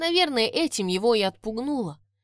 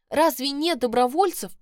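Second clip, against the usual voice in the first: hopeful blurt; 6 semitones higher, 5 dB louder than usual. Acted. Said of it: "выпалила я с надеждой".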